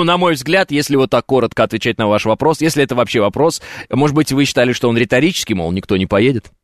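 ordinary talk, nothing marked

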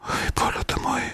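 exasperated whisper under the breath. Real, not acted. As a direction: whisper it to yourself in dismay. Fumbling for the wrong recording.

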